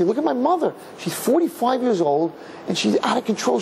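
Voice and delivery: high-pitched pitying exclamation; pitying child. Acted. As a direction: marvel in pity at a parent, pitched high. Child voices pity for yelling mother.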